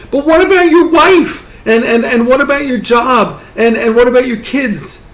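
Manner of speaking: high, stammering protest called after someone; urgent calling out. Acted. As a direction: call after him in hammed-up bewilderment. Bystander calls after the departing man.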